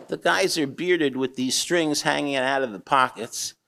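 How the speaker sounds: normal talking voice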